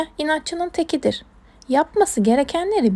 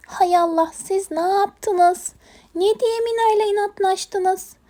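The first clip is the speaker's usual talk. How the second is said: high, slow exasperation; dismayed scolding; 7 semitones higher, slower than usual. Acted. Owner scolds animals over the game, high drawn-out dismay. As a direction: draw out the reproach, pitch raised.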